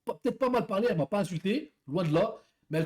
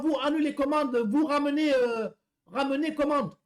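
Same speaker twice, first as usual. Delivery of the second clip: high, slowed broken French in a put-on foreign accent; mock foreign accent; 8 semitones higher than usual. Driver mimics the angry customer on the phone, mock foreign accent. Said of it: "je vous fais l'accent".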